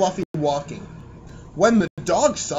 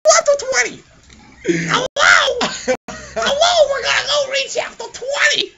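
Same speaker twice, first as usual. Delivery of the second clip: much higher, loud announcer shout; hyped announcer; 4 dB louder than usual. Pretend. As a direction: hype it up like a TV host. Hyping chapter twenty like announcer.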